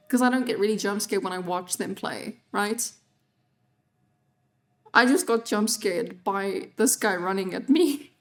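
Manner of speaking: usual speaking voice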